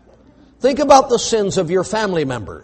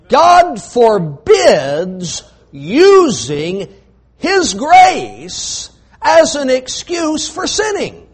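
very slow, high, weighty pulpit line; solemn sermon proclamation; slow emphatic preaching; 6 semitones higher, 5 dB louder, much slower than usual. Acted. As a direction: lean hard on every word.

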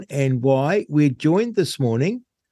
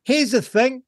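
upbeat, higher radio announcer voice; radio host intro; 9 semitones higher than usual. Acted. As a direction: sell the show opening to listeners. Radio host opening the show.

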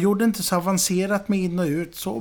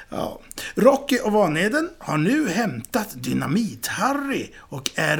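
ordinary delivery

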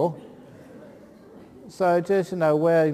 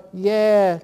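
usual speaking voice

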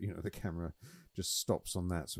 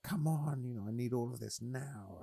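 mock impatient, pitched high; whiny demand, high and fed up; 7 semitones higher than usual. Frustrated at phone rebooting.

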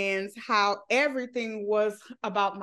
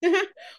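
laugh; 8 semitones higher, 3 dB louder than usual. Real, not acted. Laughing at own situation.

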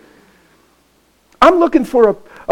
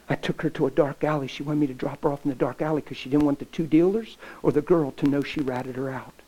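ordinary delivery

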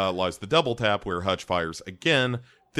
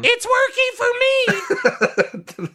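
very high, loud mock shriek; hammed-up piercing yell; screeching self-talk; 7 dB louder, faster than usual. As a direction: shriek it in manic triumph. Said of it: "Is that how she says it to herself?".

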